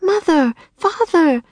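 high startled call of the parents; as if it just came to mind; sudden remembering. Acted. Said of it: "as if he just remembered their parents".

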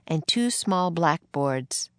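ordinary delivery

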